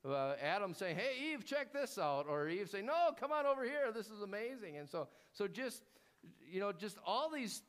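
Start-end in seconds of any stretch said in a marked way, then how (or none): 1.0-2.3 high, eager Adam calling out; excited call. Acted. Adam calls Eve to see, excited. 2.9-4.8 high, delighted Eve calling back; excited call. Acted. Eve calls Adam over, delighted and amazed.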